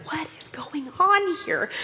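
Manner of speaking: whispered, slow amazement; awed whisper. Retold or imitated. Watching her friend do time zone math, awed whisper. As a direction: breathe it out in wonder.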